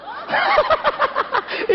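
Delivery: a real laugh at the punchline; high burst of laughter. Real, not acted. Preacher laughs at the pastor who knew only John 3:16, real laugh.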